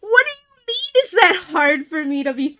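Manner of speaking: high-pitched mock outrage; indignant retold comeback. Retelling a comeback to dad, high mock indignation. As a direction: act out flustered, squawked disbelief.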